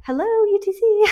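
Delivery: high, loud excited greeting; excited hello. Retold or imitated. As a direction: call out brightly with high excitement. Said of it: "I'm like".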